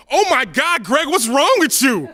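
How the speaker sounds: high, loud horrified cry; shocked exclamation. Retold or imitated. Friend shocked at what Greg told his girlfriend, loud outcry. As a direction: gasp it out appalled.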